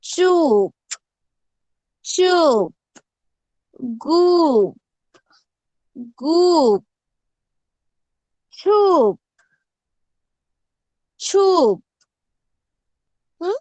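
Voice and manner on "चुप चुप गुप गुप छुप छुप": चुप, गुप, छुप spoken loudly and carefully, each word set apart by a pause; teacher demonstrating words with a short u, louder and higher; clear model reading. Shown as a demonstration. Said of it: "अंत में ऊ का उच्चारण करना है". (चुप, गुप, छुप ज़ोर से, साफ़ उच्चारण)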